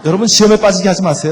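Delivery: half-joking sermon plea; loud plea. Real, not acted. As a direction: urge them not to test husbands. Preacher pleads with audience.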